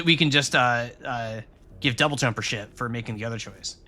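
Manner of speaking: ordinary talk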